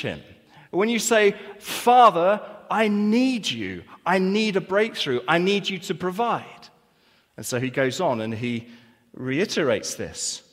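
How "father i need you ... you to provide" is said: louder, higher plea, "need" pressed hard; pleading prayer cry. Retold or imitated. Believer crying out to the Father in need, loud and pleading.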